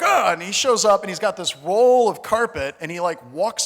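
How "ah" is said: high, short mock yell; imitated yell back. Comedian imitating the carpet man answering her, mock yell. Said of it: "And he's like".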